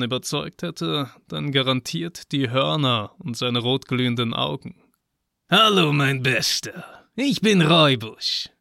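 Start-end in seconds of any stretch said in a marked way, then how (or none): 5.5-8.5 cheerful booming satyr greeting; big jolly goat-man, beaming hello; sly goat man voice. Acted. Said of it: "begrüßte mich der Satyr fröhlich". (fröhliche, hohe Satyrstimme)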